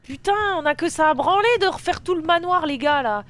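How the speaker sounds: real loud exasperated rant. Real, not acted. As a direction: vent at the chat about redoing everything. Must redo the manor, ranting.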